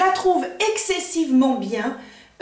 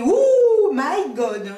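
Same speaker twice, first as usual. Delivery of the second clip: shocked; 3 dB louder, much slower than usual. Retold or imitated. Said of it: "je fais".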